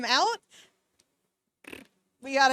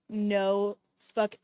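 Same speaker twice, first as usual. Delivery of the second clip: ordinary delivery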